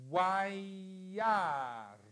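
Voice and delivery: wayar read slowly and clearly for the class to repeat; Hebrew word read aloud. Shown as a demonstration. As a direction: sound out wayar. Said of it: "leggiamola insieme". (lettura lenta di wayar)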